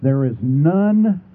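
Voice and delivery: There is none, slow with none stressed; slow weighted none; emphasized. Shown as a demonstration. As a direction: lean hard on none. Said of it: "Please emphasize this".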